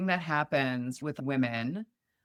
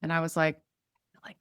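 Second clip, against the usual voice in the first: ordinary talk, nothing marked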